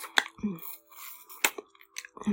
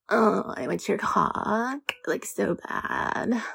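slow creaky vocal fry drag; 4 semitones higher, much slower than usual. Shown as a demonstration. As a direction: drawl it out with heavy fry. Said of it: "I've been learning about vocal fry".

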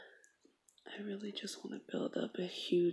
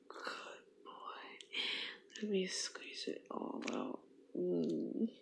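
soft, loving whisper; tender whisper; 6 dB quieter, slower than usual. Acted. Girlfriend comforting her partner, tender whisper. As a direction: soothe him in a whisper. Intimate roleplay.

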